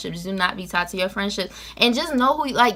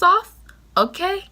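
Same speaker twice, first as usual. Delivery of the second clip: high, louder jab on the last word; emphatic push; 11 semitones higher, 4 dB louder, slower than usual. Real, not acted. Vlogger urging seniors to fight it, high and emphatic. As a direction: pop the ending up high to push it.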